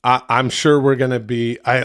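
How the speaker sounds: usual speaking voice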